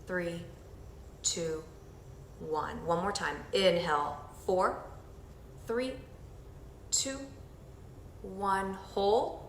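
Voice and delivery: soft, slow counting through each breath; guided breathing count. Acted. Instructor leading the group through breathing, soft slow count. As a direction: guide them through the breath, gently and slowly.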